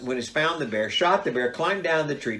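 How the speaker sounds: ordinary talk, nothing marked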